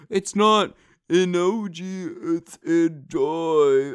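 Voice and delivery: high, slow mock-correcting commenter; mock commenter correction. Mocking imagined commenters correcting him, slow and high. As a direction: drag it out like a smug commenter. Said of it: "correct me in the comments, be like".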